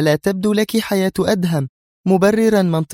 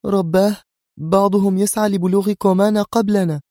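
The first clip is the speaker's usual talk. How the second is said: high-pitched, urgent exclamation; alarmed cry; 13 semitones higher, 3 dB louder than usual. A narrator's reading. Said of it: "خفق معه قلب منى وهي تهتف". (هتاف بفزع)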